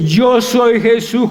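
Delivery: a lightly voiced thundering divine voice; slow, penetrating proclamation, lightly acted; Jesús. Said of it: "Esa voz tronante, esa voz penetrante".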